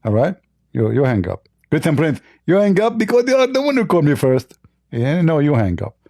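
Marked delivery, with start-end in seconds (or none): 1.7-4.6 high-pitched, lisping mock debater; Zakir Naik. Host mimicking his debate opponent, high lisping impression. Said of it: "Zakir, the".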